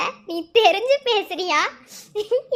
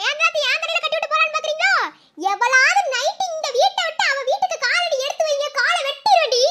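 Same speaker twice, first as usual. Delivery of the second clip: mock-fierce threat; 7 semitones higher than usual. Woman warning the others not to leave tonight, threatening. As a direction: threaten them in a raised, high-pitched voice. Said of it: "இந்த அளவுக்குலாம் மிரட்டக்கூடாதுமா".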